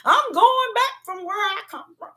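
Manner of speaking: high, tearful wail of protest; imitated crying. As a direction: mimic bawling that you've had enough. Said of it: "I was crying and I was saying".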